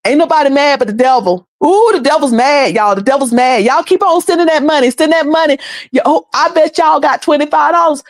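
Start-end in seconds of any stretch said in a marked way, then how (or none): none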